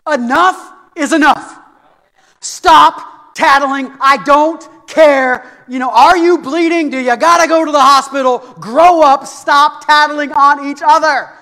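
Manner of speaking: loud, emphatic scolding, each command hammered; fed-up parent. Retold or imitated. Parent who has had enough of tattling, exasperated. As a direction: lay down the law at full volume.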